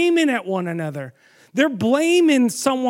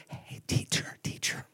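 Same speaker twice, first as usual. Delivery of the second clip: tiptoeing whisper, like waking someone gently; mock polite whisper; 13 dB quieter than usual. Preacher mocking the disciples with a timid whisper. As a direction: whisper it timidly, barely there. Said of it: "And I'm sure that's how they said it".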